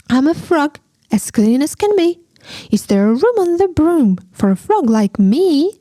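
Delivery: high, quick froggy voice. Acted. Frog begging the witch for a ride, high quick voice. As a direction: chirp it out like an eager frog.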